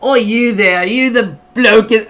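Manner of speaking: high, loud attempt at an Australian accent. Australian hailing the bloke at Visa, blustering Australian accent. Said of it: "a Australian".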